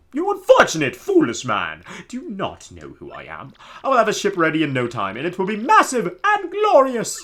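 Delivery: grand, high theatrical nobleman voice; pompous Flavio. Acted. Streamer voicing Flavio to the player, hammy pompous. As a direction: ham up the vain pirate-loving noble.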